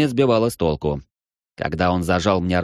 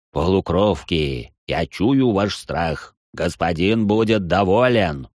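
slow, menacing demon taunt; sinister demon voice; slower than usual. Acted. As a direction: gloat like a hunting monster. Demon taunts while hunting them.

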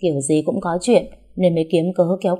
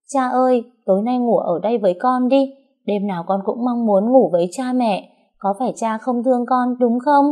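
put-on higher child's voice, pouting and pleading; a pouting child begging father to stay, higher; sulky little girl; 6 semitones higher than usual. A narrator's reading. Little Xíu sulks and begs father to sleep with her, higher child voice. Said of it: "Con Xíu xị mặt".

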